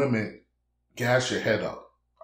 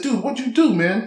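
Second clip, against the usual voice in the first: much higher and a bit louder, retold amazement; amazed; 11 semitones higher, 4 dB louder than usual. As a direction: blurt it out as if stunned by the photo. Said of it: "I said".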